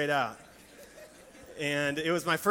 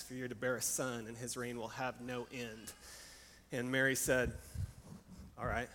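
hushed scripture storytelling; 2 semitones lower than usual. A narrator's reading. Retells the gospel story hushed.

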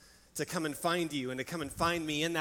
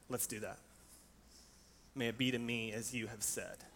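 hushed scripture storytelling; 2 semitones lower than usual. A narrator's reading. Retells the gospel story hushed.

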